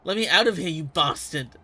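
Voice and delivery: furious yell. Acted. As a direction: yell to be let out. Captive yelling through the wall.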